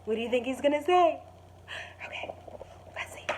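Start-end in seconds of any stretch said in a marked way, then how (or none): 2.0-3.2 hushed aside before the page turn; soft whispered let's see. Real, not acted. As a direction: whisper it to build suspense. Teacher pauses before reading on.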